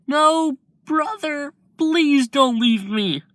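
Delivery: put-on high, slow, sobbing little-boy plea; crying child. Izuku begging his dying brother, high tearful child voice. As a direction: cry out for him not to go. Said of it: "Izuku crying, saying".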